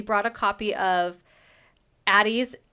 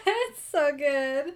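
slow, high, swooning gush; 6 semitones higher, much slower than usual. Real, not acted. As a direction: melt over every word, drawn out. Swooning over the book.

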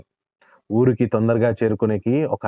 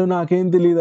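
wailing protest; 6 semitones higher than usual. A narrator's reading. Caught by soldiers, Dhanayya wails his innocence. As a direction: plead innocence in a wail. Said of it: "లబోదిబోమని మొత్తుకోసాగినాడు".

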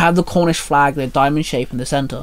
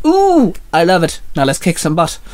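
excited yell; 2 semitones higher than usual. A narrator's reading. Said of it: "S D yelled".

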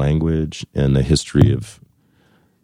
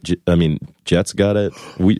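normal talking voice

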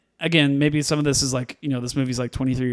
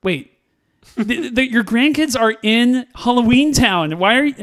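high, loud, laughing disbelief; incredulous; 8 semitones higher, 5 dB louder than usual. Retold or imitated. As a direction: protest in baffled amusement, voice pitched way up. Acts out disbelief at the scene.

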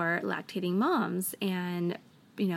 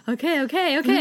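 higher, louder, hurried-sounding okays; imitating husband; 6 semitones higher, 7 dB louder, faster than usual. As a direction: act out a husband stepping in. Husband takes over fussy baby.

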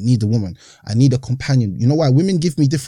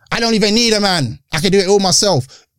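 mocking woman voice; 5 semitones higher than usual. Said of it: "You know what women say now?".

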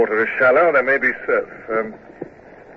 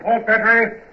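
shouted order; 11 semitones higher, slower than usual. Acted. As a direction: yell the order to fire. Ordering a broadside.

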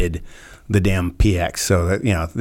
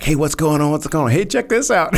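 excited gawkers; 9 semitones higher, 3 dB louder than usual. Retold or imitated.

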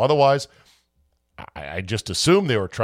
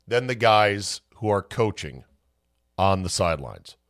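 usual speaking voice

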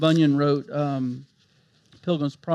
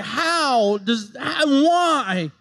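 higher, pleading questions; anxious doubter; 8 semitones higher than usual. Retold or imitated. Preacher voicing a doubter's pleading questions. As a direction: voice a worried believer's doubts, higher. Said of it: "questions that pop up like".

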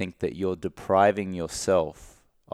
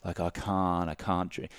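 softer, slow, defeated I can't; doom and gloom refusal; gloomy and down; 3 semitones lower, 8 dB quieter than usual. Shown as a demonstration. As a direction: sigh it out like a loss. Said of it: "your whole body language and the tonality is down".

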